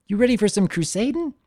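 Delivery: put-on high young filly voice, eager. Filly asking Spike to go crusading, high and eager. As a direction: pitch it up like an excited little girl.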